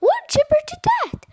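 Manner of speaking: high, loud wail of doom; frantic storybook shriek; panicked cry. A narrator's reading. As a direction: shout it in hammy terror. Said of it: "cried Fluffy".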